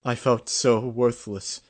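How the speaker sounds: a touch of heaving sobs under the words; acted sobbing. A narrator's reading. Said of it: "said between heaving sobs".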